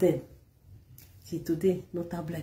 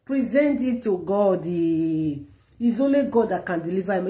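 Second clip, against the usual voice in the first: ordinary delivery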